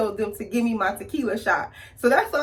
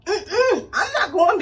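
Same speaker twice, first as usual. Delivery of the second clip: usual speaking voice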